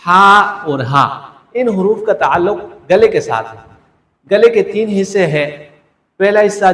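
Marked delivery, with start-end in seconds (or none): 0.1-1.3 ہا and ہا said slowly and a little louder; slow letter demo. Shown as a demonstration. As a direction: set out the two ہا sounds slowly. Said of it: "دو حروف ہیں". (دونوں ہا آہستہ اور واضح)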